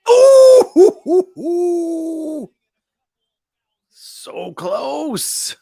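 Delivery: high, loud whooping at the near goal; excited near-miss cry. Real, not acted. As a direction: whoop as the shot just misses. Near miss on Messi shot.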